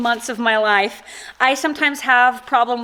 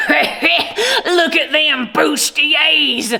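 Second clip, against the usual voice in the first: crass customer voice; 5 semitones higher, 3 dB louder, much slower than usual. Retold or imitated. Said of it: "she was like".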